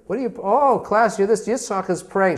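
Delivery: mock the little boy in front of everyone; mocking teacher. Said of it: "She's making fun of him".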